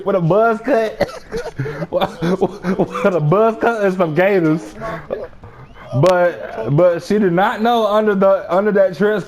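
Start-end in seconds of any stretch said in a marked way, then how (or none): 0.0-4.7 laughing through it. Real, not acted. Cracking up retelling outfit.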